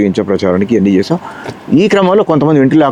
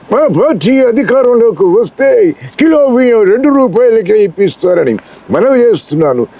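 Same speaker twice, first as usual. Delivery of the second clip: much higher, slow, booming political oration; NTR booming speech; 10 semitones higher, slower than usual. Shown as a demonstration. Mimic shows NTR addressing the women of Telugu land, booming and slow. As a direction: thunder it out like a grand hero on stage. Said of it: "Ramarao గారు గంభీర".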